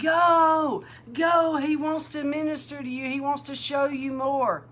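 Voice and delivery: high, loud, excited urging; voiced as eager friends shouting go; urgent excited urging. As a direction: push her out the door, thrilled. Hosts urging her to go.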